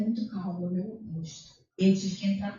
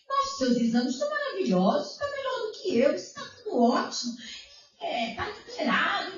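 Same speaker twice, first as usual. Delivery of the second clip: high, impressed doctor voice; amazed doctor; 8 semitones higher than usual. Retold or imitated. Doctor marvelling at the patient's results, voice lifted high. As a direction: play the stunned doctor praising the results.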